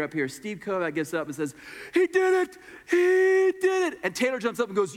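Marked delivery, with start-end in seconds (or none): 1.9-4.0 high, loud cry of triumph; boy cheering that his friend found Jesus, shouting with joy; excited shout. Retold or imitated. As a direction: yell it out in joy across the camp.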